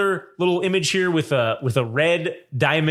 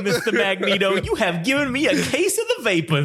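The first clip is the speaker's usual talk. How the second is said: high, fluttery Southern genteel voice; swooning Southern belle accent; Southern gentleman drawl; 4 semitones higher than usual. Retold or imitated. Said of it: "He's like".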